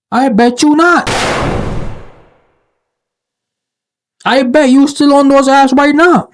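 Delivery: high, loud playful ribbing; calling her bluff with a singsong jab; teasing disbelief. Real, not acted. Commentator teasing the poster about dating apps, high mocking singsong. Teasing the woman who says she's done dating.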